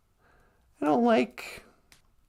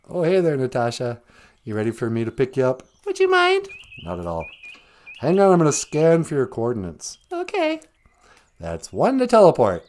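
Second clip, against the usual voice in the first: much lower, steady captain voice; a captain answering Natasha before teleport, much lower; deep captain voice; 11 semitones lower, faster than usual. Pretend. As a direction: play a starship captain. Acted sci-fi captain.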